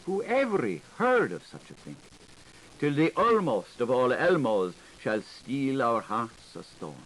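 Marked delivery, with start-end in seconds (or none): 0.0-2.0 shocked voice. Acted. Scandalised quoted exclamation.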